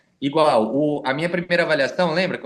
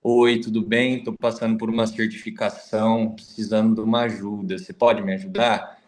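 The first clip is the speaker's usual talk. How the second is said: na bad; 6 semitones lower than usual. Shown as a demonstration. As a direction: read it like someone feeling down. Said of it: "ela vai ler assim". (lido na bad, desanimado)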